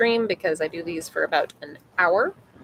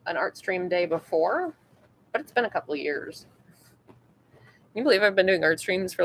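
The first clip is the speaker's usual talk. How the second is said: normal talking voice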